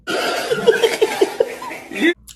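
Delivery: high burst of unvoiced laughter; loud laugh. Real, not acted. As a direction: crack up loudly mid-sentence. Burst laughing mid-story.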